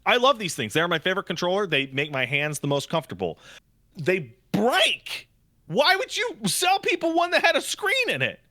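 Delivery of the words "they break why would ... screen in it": high-pitched, incredulous complaint; baffled, exasperated rant; exasperated outburst. Real, not acted. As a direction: let the frustration shoot your pitch right up. Exasperated rant about fragile controllers.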